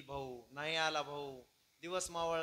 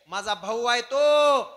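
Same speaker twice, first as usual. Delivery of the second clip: loud, high and slow declaration; defiant shout; 7 semitones higher, 18 dB louder, slower than usual. Acted. Husband defying his wife for his brother, shouting. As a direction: hammer it out and hold the last word.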